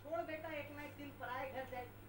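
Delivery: a hint of a resigned father's cliché; old father's dialogue. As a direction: sigh it like a tired old dad. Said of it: "ढाई सौ साल पुराना dialogue मारा".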